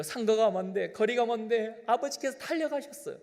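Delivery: normal talking voice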